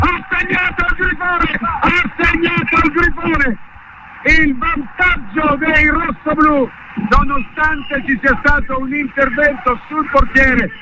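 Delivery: high, elated goal recap; breathless italian goal commentary. Real, not acted. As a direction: still buzzing after the goal. Goal celebration continues.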